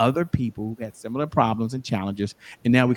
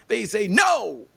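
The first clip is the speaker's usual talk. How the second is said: retold attorney; 9 semitones higher, much slower than usual. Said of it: "my attorney, said".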